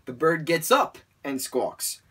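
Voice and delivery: ordinary delivery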